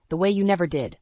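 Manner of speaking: usual speaking voice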